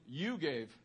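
pointed, drawn-out "you" before "gave"; stress on YOU. Shown as a demonstration. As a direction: lean hard on "you". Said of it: "And the emphasis was on".

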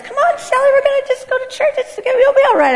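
very high, sing-song coaxing voice; Garrett. Retold or imitated. Husband coaxing his wife into church, high mocking impression. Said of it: "Garrett goes".

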